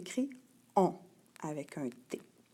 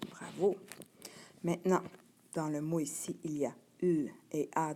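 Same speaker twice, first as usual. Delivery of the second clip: usual speaking voice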